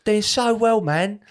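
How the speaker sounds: lightly hammed drunk admirer; mock drunk fan. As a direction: drunk fan telling the comedian he's doing well.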